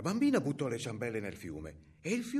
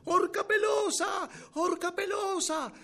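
very high, slow taunting shout; girl shouting; 6 dB louder, slower than usual. A narrator's reading. Girl shouting at the hairy ogress, high drawn-out yell. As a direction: yell it high, stretching the vowels. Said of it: "la bambina si mette a gridare".

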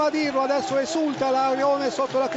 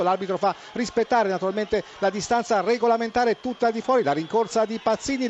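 usual speaking voice

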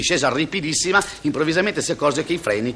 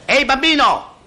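scolding call; 9 semitones higher than usual. Acted. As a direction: call across the pool at the boy. Lifeguard scolds child at pool.

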